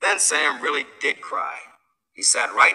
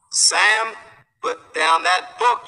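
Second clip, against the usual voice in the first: louder, higher, slow yell at a little brother; big brother yelling; 6 semitones higher, 5 dB louder, slower than usual. A narrator's reading. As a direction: brother snaps at Sam over his book. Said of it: "yelled George".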